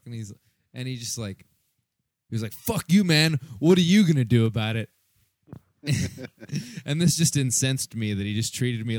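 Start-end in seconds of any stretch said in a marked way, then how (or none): 2.5-4.9 angry retort. Retold or imitated. Retold jerk on the stairs.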